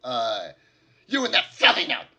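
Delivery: high, loud scornful goblin voice; indignant goblin. Acted. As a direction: sneer it like an offended goblin. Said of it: "Gundar turns to you and says".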